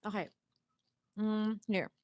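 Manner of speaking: talking with a mouth full. Real, not acted. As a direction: mouth full while apologizing. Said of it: "I'm eating".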